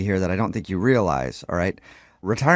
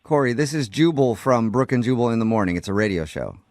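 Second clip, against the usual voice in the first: ordinary talk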